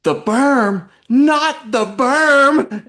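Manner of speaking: high, loud, drawn-out squeal on berm; imitating little sister. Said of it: "she stops and goes".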